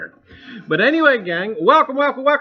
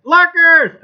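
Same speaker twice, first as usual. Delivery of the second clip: louder, higher call to the lurkers; playful shout; 10 semitones higher, 14 dB louder than usual. Real, not acted. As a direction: shout it out, teasing the silent viewers. Calling out to lurkers.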